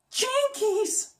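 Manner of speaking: high, soft Velma exclaim. Shown as a demonstration. Bonus demo, Velma's high soft catchphrase. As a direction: gasp it out high and nerdy. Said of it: "I could also do Velma too".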